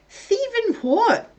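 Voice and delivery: high, slow incredulous question. Retold or imitated. Asking the sleep-talking partner, high incredulous. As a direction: stretch out the disbelief, pitched right up.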